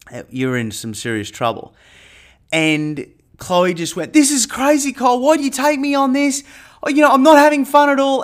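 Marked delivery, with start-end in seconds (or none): 4.1-8.2 high, loud panicked pleading, speeding up at the end. Retold or imitated. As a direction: imitate her losing it on the cliff. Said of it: "she was panicked".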